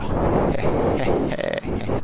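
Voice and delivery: loud breathy chuckle at own joke; real airy belly laugh. Real, not acted. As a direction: wheeze out a long, loud laugh. Laughs at his own pun.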